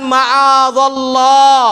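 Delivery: loud, long-drawn declaration; emphatic refusal. Retold or imitated. Preacher quoting Yusuf seeking refuge in Allah, emphatic declaration. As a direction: stretch it out firmly, at a strong volume.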